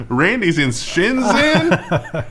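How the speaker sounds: high, loud, laughing disbelief; mock astonishment. User finds a friend's device in China, mock astonishment. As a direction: cry it out slowly, cracking up. Joking along with the imagined discovery.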